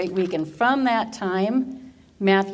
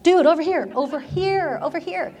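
high, eager over here; eager calling out; 9 semitones higher than usual. Retold or imitated. Young teacher at a conference calling to the speaker, higher and eager. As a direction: call out, desperate to be picked.

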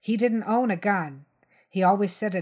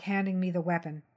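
ordinary delivery, nothing marked

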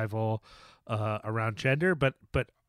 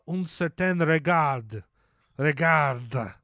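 slow, showy French accent; 2 semitones higher, 5 dB louder, much slower than usual. Shown as a demonstration. Host showing off a festival category name, French accent. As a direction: put on a deliberate French accent, drawn out. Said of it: "That's my French accent".

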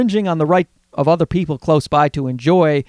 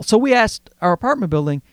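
ordinary delivery

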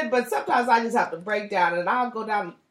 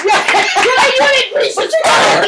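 laughing shout; 10 semitones higher, 7 dB louder, slower than usual. Real, not acted. Teasing friend mock-scolds loudly.

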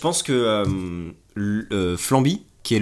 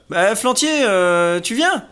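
high, louder call over the shoulder; calling back to a pal; 9 semitones higher, 5 dB louder, much slower than usual. Acted. Soldier rats calling back to Flantier, high and loud. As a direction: call out to the straggler behind.

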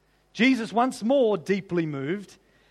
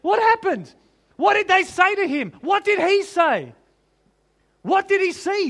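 excited wonder; 9 semitones higher, 5 dB louder than usual. Real, not acted. Excited wondering, high pitch.

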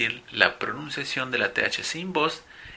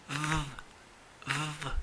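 a bare th hiss held out with no vowel; just the th, tongue between the teeth; isolated th sound; 2 semitones higher, 12 dB quieter than usual. Shown as a demonstration. Teacher isolates the th sound for the students, quiet and held. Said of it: "el sonido en este caso es th".

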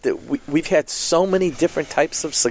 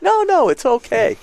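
friend insisting; 11 semitones higher than usual. Retold or imitated. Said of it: "And he's going".

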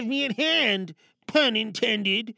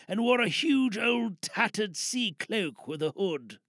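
story narration; 5 semitones lower, 4 dB quieter than usual. Acted. Narrates Treasure Island scene.

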